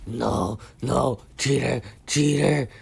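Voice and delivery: bratty villain barking orders; Shigaraki. Acted. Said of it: "And Shigaraki's like".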